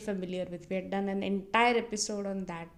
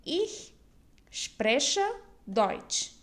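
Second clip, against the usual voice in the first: "Ich", "spreche", "Deutsch" with a pause between each; word by word; 2 semitones higher, much slower than usual. Shown as a demonstration. Teacher recalls a learned sentence, word by word. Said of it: "used the sentence".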